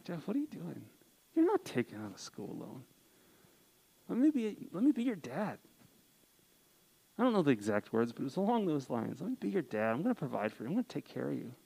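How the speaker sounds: dad's reassurance. Retold or imitated. Dad's phone call retold.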